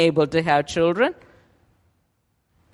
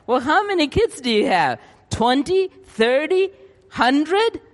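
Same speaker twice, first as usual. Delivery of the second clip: mock incredulous; 9 semitones higher than usual. Townsfolk mock Abraham's name, rising in mock disbelief. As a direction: pitch climbing higher with each number.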